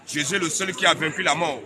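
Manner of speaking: usual speaking voice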